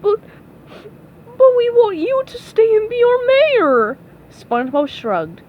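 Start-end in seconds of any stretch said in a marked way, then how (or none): 0.0-4.0 high, loud, tearful plea; acted crying. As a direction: wail it like a hammed-up sob. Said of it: "The man began to cry".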